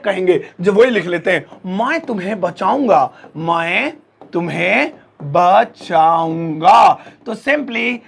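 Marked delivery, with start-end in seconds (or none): 1.7-7.0 word by word, with long gaps; slow dictation. Shown as a demonstration. Teacher writing the Hindi example for the class, slow dictation. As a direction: say it slowly as if writing each word. Says sentence while writing it out.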